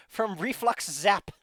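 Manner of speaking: high and loud through laughter; laughing. Real, not acted. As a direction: laugh it out, slow. Laughs over zap damage.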